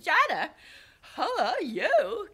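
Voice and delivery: very high, loud mock English accent, drawn out; put-on English accent. Manager greeting her every day, put-on English accent. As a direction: imitate his English accent, stretching the words. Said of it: "trying to put on this English accent".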